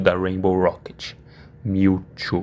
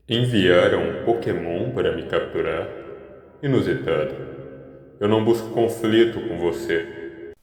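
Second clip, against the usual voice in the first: lightly voiced cool, composed Mewtwo, a bit softer; unbothered legendary Pokémon, measured; calm Mewtwo; 3 dB quieter than usual.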